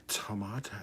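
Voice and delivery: "tomato" with a broad "MAH" in the middle; mock the British "to-MAH-to"; British pronunciation. Streamer mocks how Brits pronounce words, British pronunciation. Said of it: "they have to pronounce every".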